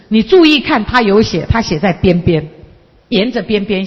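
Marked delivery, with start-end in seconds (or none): none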